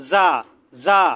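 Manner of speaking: "জা" said with a thin z sound; som fino. Shown as a demonstration. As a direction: keep "জা" light and thin. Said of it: "একবারে পাতলা করে".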